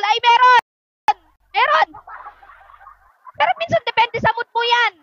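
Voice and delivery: high, bright "Meron!" shouted with glee; excited answer. Real, not acted. Vlogger answers a viewer's peanut question with excited glee. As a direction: burst out with an eager yes.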